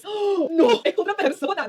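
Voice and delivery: loud, high cry of disbelief; shocked cry. Real, not acted. Watching a nose job reveal, loud shocked cry. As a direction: blurt out in shock at the result. Rhinoplasty before and after reveal.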